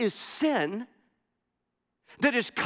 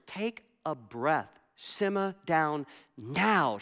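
slow, lower, measured calming; calming wife; 5 semitones lower, 4 dB quieter, slower than usual. Retold or imitated. Wife to her panicking husband, slow and calming. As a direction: slowly talk someone down from a panic.

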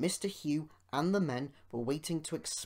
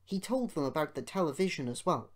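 ordinary delivery, nothing marked

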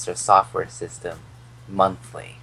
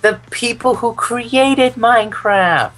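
exasperated; 13 semitones higher, 6 dB louder than usual. Real, not acted. Exasperated correction.

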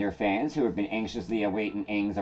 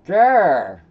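short loud annoyed growl; frustrated growl; 7 semitones higher, 8 dB louder than usual. Real, not acted. Frustrated at undeletable link.

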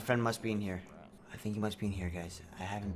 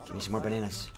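ordinary talk, nothing marked

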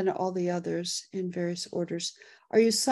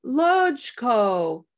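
higher and louder announcement of the winner; excited winner call; 7 semitones higher, 6 dB louder, much slower than usual. Real, not acted. Host announcing the giveaway winner, excited. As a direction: crow the winner's name.

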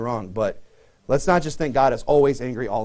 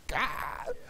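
exasperated; 10 semitones higher, 13 dB quieter than usual. Retold or imitated. Exasperated parent sighs.

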